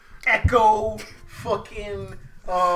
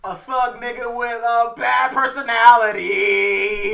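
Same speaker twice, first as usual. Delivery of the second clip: wrestling promo; 4 semitones higher, 7 dB louder, slower than usual. Pretend. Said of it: "I'm gonna cut a promo".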